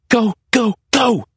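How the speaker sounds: high, driven bursts; urgent self-urging. A narrator's reading. Maria urging herself to flee Lester's gun, urgent. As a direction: push each word out in panic.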